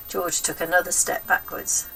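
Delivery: usual speaking voice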